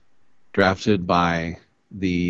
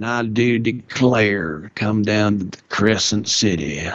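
New Orleans gentleman. Retold or imitated. Imitating a New Orleans gentleman inviting you to the Crescent City, light Southern accent. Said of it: "some sort of New Orleans gentleman".

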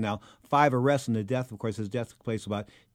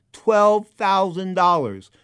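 "Twelve thousand dollars" slow, loud and raised; emphatic drawn-out figure; 7 semitones higher, 7 dB louder, much slower than usual. Retold or imitated. Host owed money, dwelling hard on "Twelve thousand dollars". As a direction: stretch every word of "Twelve thousand dollars".

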